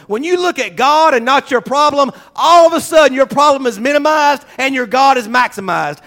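normal talking voice

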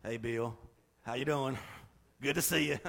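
low, hushed, fake-friendly hello; fake greeting. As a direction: mutter a greeting you don't mean. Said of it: "You don't say".

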